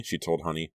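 usual speaking voice

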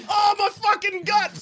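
high pained scream; bleeding out. Acted. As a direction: scream clutching your guts. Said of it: "sample your lines from Reservoir Dogs".

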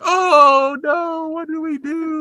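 high, drawn-out mock wailing; melodramatic despair. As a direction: wail like grief-stricken parents, hammed up. Parents despair in blindness daydream.

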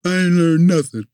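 put-on high, loud, sulky boy's retort; mad kid. Boy sulkily answering grandma's question, loud and high. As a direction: snap it back like a sulking kid. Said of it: "I'm mad".

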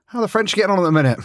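ordinary delivery

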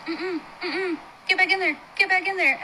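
put-on high, insistent little child. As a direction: urge it like a bossy child. Child spirit urging mom back.

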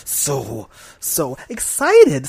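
excited. Acted. Drawn-out mock excitement.

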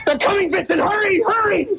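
high, frantic cry for him to hurry; panicked shout. Acted. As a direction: scream at him to hurry, terrified. Pursuers approach, Arthur calls out.

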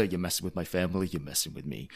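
low, quiet tough-guy growl; menacing mob threat; [name]'s gangster voice, low and quiet. Retold or imitated. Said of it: "the gangster".

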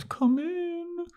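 put-on very high falsetto girl voice; high falsetto Willow. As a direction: squeak it up like a young woman. Podcast recap voicing Willow.

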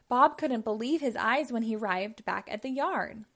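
usual speaking voice

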